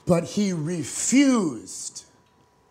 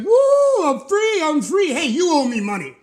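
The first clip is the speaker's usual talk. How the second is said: loud, much higher whoop of freedom; elated servant; 10 semitones higher, 8 dB louder than usual. Acted. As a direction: burst out of jail yelling with joy. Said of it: "he's like".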